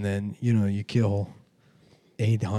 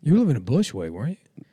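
loud, high, swooping protest; incredulous challenge; 8 semitones higher, 5 dB louder than usual. Real, not acted. Friend disputing where the other lived, loudly incredulous. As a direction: call out your friend's claim in exaggerated disbelief.

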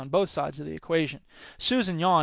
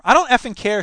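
raised, louder indignant voice; angry outburst; 6 semitones higher, 8 dB louder than usual. Acted. Susan balling up her napkin at Karen, angry. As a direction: let the irritation burst out.

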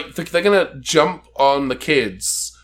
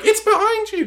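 very high, hammed-up panto cry; pantomime call-out. Describing the villain's comedy entrance, high pantomime call.

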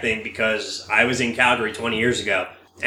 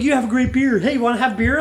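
retold friendly strangers; 10 semitones higher than usual. Imitates friendly strangers praising him.

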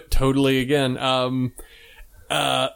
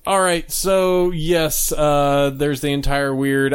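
ordinary talk, nothing marked

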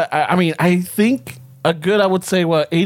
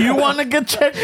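laughing mock taunt; 7 semitones higher than usual. Repeats prank line laughing.

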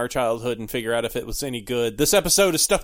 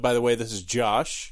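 ordinary talk

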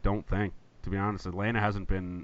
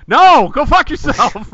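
angry fan yell; 15 dB louder, slower than usual. Retold or imitated. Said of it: "the Raiders fans were like".

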